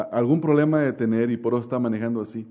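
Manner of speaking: ordinary talk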